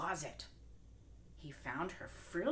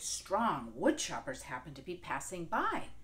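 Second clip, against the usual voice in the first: ordinary delivery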